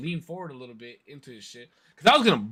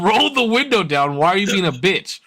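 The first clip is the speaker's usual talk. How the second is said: raised, insistent demand; confrontational; 6 semitones higher, 4 dB louder than usual. Retold or imitated. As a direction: repeat it harder to the driver. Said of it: "I said".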